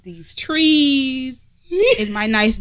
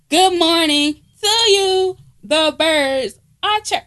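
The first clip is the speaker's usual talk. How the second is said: slow, high sing-song hello; chirpy influencer greeting; 7 semitones higher, 4 dB louder, slower than usual. Retold or imitated. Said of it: "these kids that's on Instagram".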